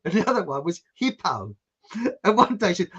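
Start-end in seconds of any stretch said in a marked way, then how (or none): 1.0-1.5 "hippo" in an imitated Rhodesian accent. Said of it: "about his accent".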